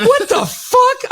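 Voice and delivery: high, slow disbelieving what the fuck; hammed-up shock at a faked demo; mock outrage.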